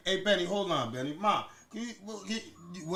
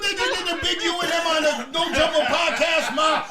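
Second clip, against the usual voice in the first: loud, high teasing through laughter; laughing shout; 6 semitones higher, 5 dB louder than usual. Real, not acted. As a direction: roast your mom while laughing. Teasing mom loudly, laughing.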